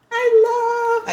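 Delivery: very high, loud squeal; excited squeal. Real, not acted. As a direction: squeal with joy. Fantasy gush.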